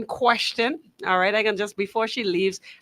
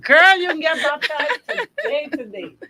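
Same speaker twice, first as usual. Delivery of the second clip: laughing; 7 semitones higher, 6 dB louder than usual. Retold or imitated. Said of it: "I said".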